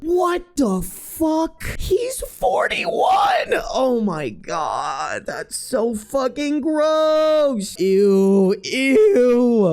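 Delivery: squealing high-pitched shock, slowed and drawn out; shocked disgust. Real, not acted. Reacting to the cheating husband with shrieking disgust.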